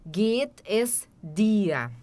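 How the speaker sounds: "Geht es dir" drawn out, "es" fully sounded; slowly unpack "geht es" for the class; very slow demo. Shown as a demonstration. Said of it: "esse apóstrofe S aqui é o es".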